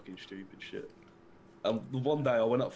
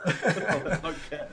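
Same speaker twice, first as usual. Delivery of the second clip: slow words broken by a laugh; 4 semitones higher, 5 dB louder, much slower than usual. Real, not acted. Shrugging off the slang talk with a laugh. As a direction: drag it out while still laughing.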